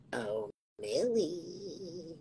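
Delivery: slow breathy whisper. Shown as a demonstration. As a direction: whisper it slowly and softly. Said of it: "three takes of the same words".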